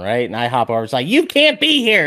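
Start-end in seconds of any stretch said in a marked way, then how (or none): 1.1-2.1 high, loud barked order from a guard; mock security guard yelling. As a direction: bark it like a guard shooing someone off.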